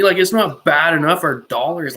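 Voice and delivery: normal talking voice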